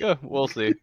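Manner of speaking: knowing smug tease. Retold or imitated. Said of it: "He makes a face to be like".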